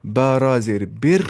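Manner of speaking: बिर said light with a thin r, slow. Shown as a demonstration. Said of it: "हल्की आवाज़ से पढ़ना है". (हल्की आवाज़ में बिर)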